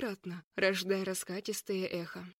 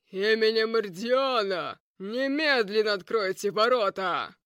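commanding shout; 6 dB louder than usual. Acted. Knight demanding gate be opened.

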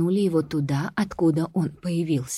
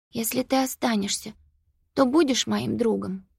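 put-on hopeful girl; 6 semitones higher than usual. A narrator's reading. Sophie asks the monster.

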